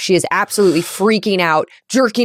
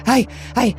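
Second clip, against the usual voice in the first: stammering, higher, gasping for breath; dry crying; 4 semitones higher, faster than usual. Acted. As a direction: sob without tears, trying to catch your breath. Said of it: "dry crying in a way where she's, like, breathing and trying to catch her breath".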